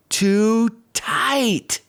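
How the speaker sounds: ordinary delivery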